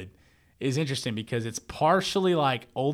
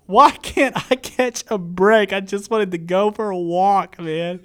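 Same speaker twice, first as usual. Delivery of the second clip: loud, high, drawn-out mock whine; exasperated complaint; 7 semitones higher, 10 dB louder, slower than usual. Dad caught in neighbors' fight on his walk, exasperated. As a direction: wail it in comic frustration.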